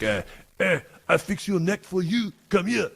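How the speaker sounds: put-on high, thick French accent of an old veteran. Veteran offering to fix my neck, in French accent. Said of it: "real French accent".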